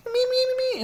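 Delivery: very high cartoon kid babble; animated character voice. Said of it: "like, an animated character".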